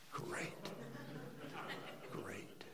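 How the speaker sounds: deflated muttering to oneself; barely voiced, acted-out letdown; dejected whisper. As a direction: whisper it with sinking disappointment. Inward reaction to bad news.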